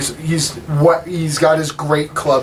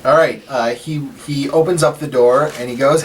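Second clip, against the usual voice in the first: normal talking voice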